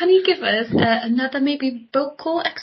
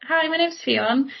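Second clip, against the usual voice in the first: slow, higher, lilting up on "Fionn"; questioning intonation; 5 semitones higher, slower than usual. Shown as a demonstration. Guest showing a habitual introduction, "Fionn" rising questioningly. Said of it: "That's a question".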